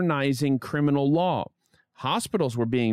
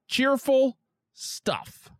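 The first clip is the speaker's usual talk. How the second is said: drawn-out dry irony on cheerful; high, slow sarcastic remark; 13 semitones higher, much slower than usual. Real, not acted. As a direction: say it slowly, clearly meaning the opposite. Sarcastic aside after gloomy story.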